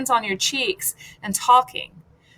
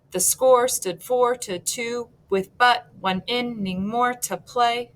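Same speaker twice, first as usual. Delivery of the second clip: "The score stood four to two" in even weak-strong pairs; counted-out meter; 2 semitones higher than usual. Shown as a demonstration. Teacher counts the feet in the next line, measured iambic chant. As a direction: chant each foot apart, slowing on "more to play". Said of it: "So let's count 'em".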